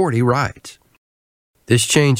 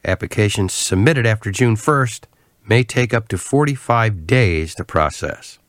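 ordinary talk, nothing marked

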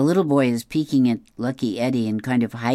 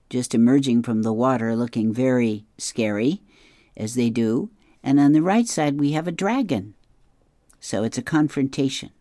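ordinary delivery

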